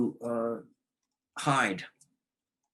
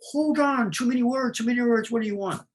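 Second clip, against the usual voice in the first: overwhelmed parent; 12 semitones higher than usual. Retold or imitated. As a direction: cut off a torrent of words in exasperation. Overwhelmed parent answering chatterbox child.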